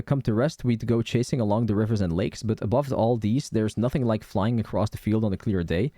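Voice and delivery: ordinary talk, nothing marked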